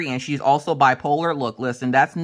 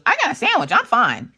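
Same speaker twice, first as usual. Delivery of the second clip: put-on high-pitched little-girl reply; high kid voice, brushing it off; daughter's voice; 8 semitones higher than usual. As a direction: imitate a young daughter, high.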